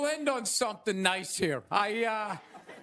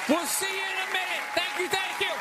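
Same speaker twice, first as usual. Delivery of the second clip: shouted, higher wrap-up to the crowd; 5 semitones higher than usual. Real, not acted. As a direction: hype the audience before the break. Host wraps up, stick around.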